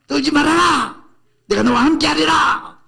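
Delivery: high, loud proclamation of God's promise; booming divine command. Acted. Preacher booming God's words to Joshua, loud and high. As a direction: shout it out as God's own voice.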